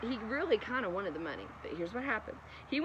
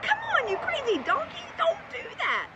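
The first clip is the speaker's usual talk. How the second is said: much higher, exasperated mock scolding; annoyed Balaam; 3 dB louder than usual. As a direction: snap at the stubborn donkey, hammed up. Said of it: "He's like".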